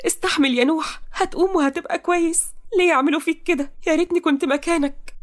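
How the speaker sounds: high-pitched, tearful plea; tearful pleading. Acted. Toqa cradling wounded Nouh on the hospital ride, tearful pleading. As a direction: beg him to hold on through tears. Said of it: "وهي بتعيط".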